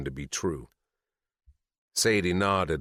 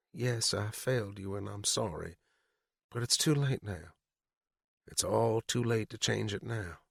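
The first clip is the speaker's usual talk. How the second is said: higher, much softer regretful apology; quietly sorry; 5 semitones higher, 5 dB quieter than usual. Acted. Mother apologizes to her son, soft and regretful. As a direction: let the sorry fall away to almost nothing.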